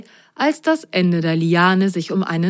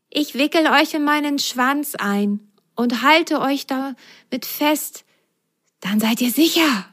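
higher, lightly hissed snake voice; hissing snake; 8 semitones higher than usual. Acted. Snake offers to hold the socks, lightly hissed. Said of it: "zischte sie Lu und Ise zu".

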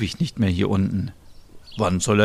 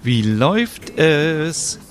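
cheery greeting; 7 semitones higher, 3 dB louder, much slower than usual. Acted. Smilla arrives greeting.

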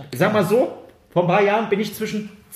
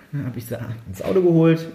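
ordinary delivery

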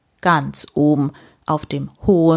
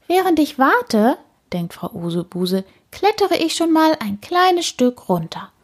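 put-on higher old lady voice; Frau Usebuse thinking; 9 semitones higher than usual. A narrator's reading. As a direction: think it up, impatient.

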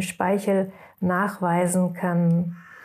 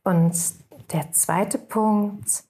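guided meditation. Acted. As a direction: guide the listeners into rest, unhurried.

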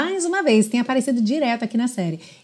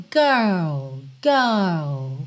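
put-on GIRL in British style, no R, "guhl"; lower, drawn-out r-less British GIRL; 5 semitones lower than usual. Shown as a demonstration. Teacher models British pronunciation, r-less and lower. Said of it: "No britânico".

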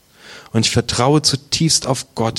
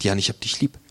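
gentle Jesus; 4 semitones lower, 6 dB quieter, faster than usual. Retold or imitated. Jesus repeats his love.

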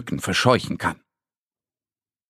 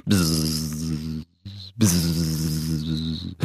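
soft, low buzzing hum; quiet droning bzzz of an insect; mosquito buzz; 6 semitones lower, 5 dB quieter than usual. Acted. Narrator imitates mosquito buzz.

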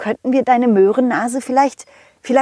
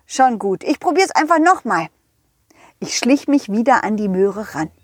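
ordinary delivery, nothing marked

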